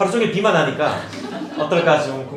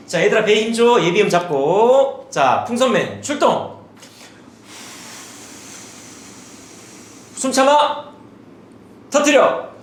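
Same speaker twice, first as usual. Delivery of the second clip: rallying teacher, pitched up and drawn out; teacher drilling children; 5 semitones higher, slower than usual. Acted. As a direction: call the children into a breathing drill. Acts out drilling children in class.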